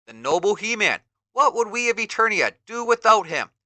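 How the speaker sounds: admiring villager. Acted. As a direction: marvel at the hero. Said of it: "one of the villagers says".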